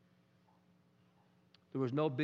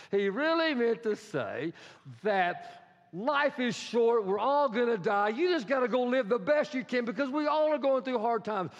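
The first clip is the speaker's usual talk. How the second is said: fervent spin; 6 semitones higher, 4 dB louder than usual. Acted. Peter spins Jesus' words.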